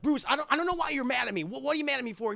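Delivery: a hint of a sulky teen; lightly voiced defensive Robin. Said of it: "and Robin's like".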